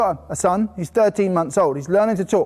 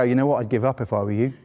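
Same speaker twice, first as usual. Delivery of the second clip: mock discouragement; 9 semitones lower, 3 dB quieter, faster than usual. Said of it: "not once did we go".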